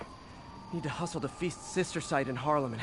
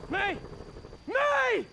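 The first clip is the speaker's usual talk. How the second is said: calling out in alarm; desperate call. Acted. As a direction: shout a name through a burning building. Calling into burning building.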